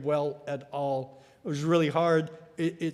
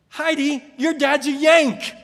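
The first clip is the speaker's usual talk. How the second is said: put-on higher, louder, slow sing-song children's call; kids' taunt; 8 semitones higher, 8 dB louder than usual. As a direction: tease like a schoolyard chorus of kids. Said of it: "The kids all in unison turn to Heidi and say".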